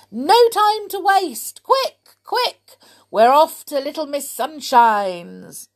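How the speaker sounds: high, loud, beaming greeting; joyful cry. A narrator's reading. Mister Mean greeting Mister Happy at his door, high joyful cry. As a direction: burst out with Christmas cheer.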